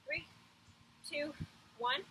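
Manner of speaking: ordinary talk, nothing marked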